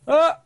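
high short mock scream of a worm; imitated worm scream. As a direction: yelp it out, high and sudden, like a dumb worm. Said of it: "I like the first, like".

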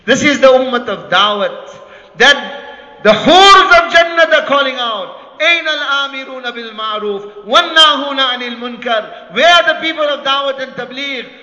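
ringing sermon call; impassioned preaching. Real, not acted. Preacher voicing the call to people of da'wat, fervent. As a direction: call out across the congregation.

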